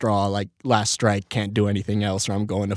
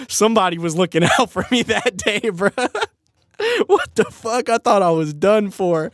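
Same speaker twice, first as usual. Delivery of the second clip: higher, retold amazement through laughter; laughing disbelief; 8 semitones higher than usual. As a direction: laugh at your narrow escape. Retells amazed relief, laughing.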